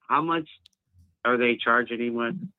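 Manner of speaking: usual speaking voice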